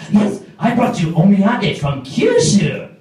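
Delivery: put-on high, slow Japanese-accented English; Japanese coworker. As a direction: announce the gift in an excited Japanese accent. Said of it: "omiyage from your coworkers".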